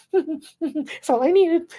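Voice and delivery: very high, slow, drawn-out imitation; mock angry woman. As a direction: stretch out her complaint way up high. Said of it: "she gets mad".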